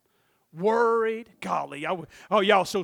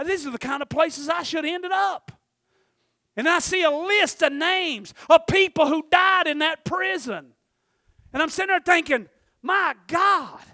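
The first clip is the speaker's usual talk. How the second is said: high, loud sermon testimony; impassioned preaching; 9 semitones higher, 10 dB louder than usual. Acted. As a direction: cry out the testimony to the congregation.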